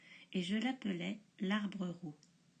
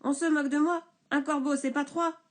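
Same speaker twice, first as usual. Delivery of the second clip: 1 indignation forte; 5 semitones higher, 6 dB louder, faster than usual. Acted. Bûcheron vexé.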